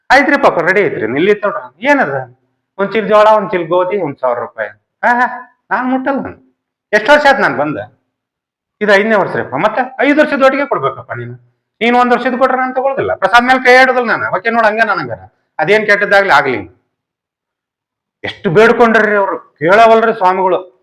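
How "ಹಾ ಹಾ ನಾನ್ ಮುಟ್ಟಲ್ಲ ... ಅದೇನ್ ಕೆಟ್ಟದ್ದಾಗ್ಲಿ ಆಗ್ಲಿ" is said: high, insistent holy man refusing; stubborn holy man, voice pitched higher; stubborn swami. Retold or imitated. Swami refusing the meal until paid for five years, insistent and higher.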